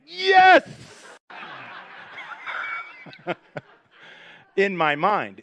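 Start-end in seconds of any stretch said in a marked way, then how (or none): 0.1-0.7 loud, high cry of relief; a big burst of glee; mock victory shout; triumphant yes. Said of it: "And I'm like". 1.3-3.3 soft, unvoiced laugh; an airy laugh of relief; quiet chuckle; breathy relieved laugh. Relief the groom is late.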